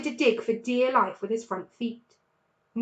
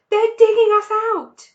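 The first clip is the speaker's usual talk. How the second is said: much higher, louder alarm shout; 11 semitones higher, 13 dB louder than usual. A narrator's reading. Mr Fox waking his family as shovels dig, shouting. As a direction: yell to wake the family. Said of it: "he shouted".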